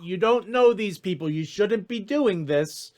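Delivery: high, worried voice over a sending stone; high-voiced, hesitant reproach; high-pitched voice. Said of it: "hear that high-pitched voice say".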